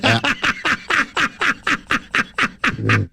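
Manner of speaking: high breathy giggle fit; laughing. Real, not acted. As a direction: burst out in giggles. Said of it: "I think I was doing a song".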